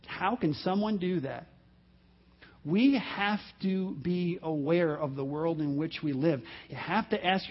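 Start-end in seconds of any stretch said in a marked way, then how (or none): 0.0-1.5 soft, hushed incredulous question; subdued disbelief. Acted. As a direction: let the question sink in, much softer. Repeats the stunned question, subdued.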